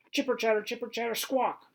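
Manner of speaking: high, loud chattering squirrel screech. Acted. Squirrel screeches at the dog to taunt him, high chattering squawk. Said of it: "he screeches".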